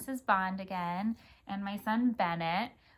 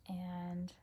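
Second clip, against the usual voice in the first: whispered prompt; 3 semitones lower, 9 dB quieter than usual. Real, not acted. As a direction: whisper a cue to your son. Prompting child to read.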